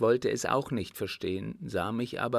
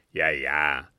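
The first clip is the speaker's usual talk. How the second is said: low, louder cutting-in; quick interruption; 7 semitones lower, 6 dB louder than usual. A narrator's reading. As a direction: break in knowingly, low and firm. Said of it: "fiel Blücher schnell ein". (schnell einfallend, tief und bestimmt)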